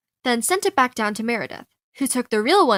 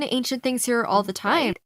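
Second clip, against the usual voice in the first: plain reading voice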